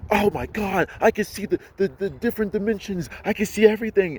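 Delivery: high-pitched, over-the-top awe; mock astonishment. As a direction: parody someone having a mind-blowing vision. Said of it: "this crazy experience".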